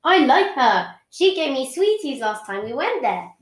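ordinary delivery

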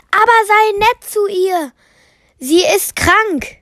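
viel höher, besorgt nachgerufen; hinterherrufendes Mädchen. Acted. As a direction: ruf ihm besorgt hinterher. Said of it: "rief noch hinterher". (Mädchen ruft dem Bären besorgt nach, hohe Stimme)

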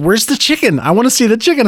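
put-on high insistent kid voice; demanding child. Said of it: "She said".

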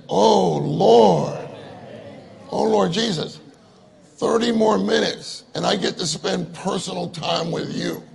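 acted joyful prayer, swelling out then settling; grateful exclamation. Preacher models waking glad for time with the Lord, joyful outcry. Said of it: "what our attitude should be".

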